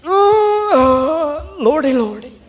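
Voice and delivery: high, drawn-out ooh then Lordy, Lordy; high Lordy moan. Retold or imitated. Second porch sitter joining the Lordy chorus, high moan. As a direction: swoop up high and sigh it down. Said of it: "somebody else says".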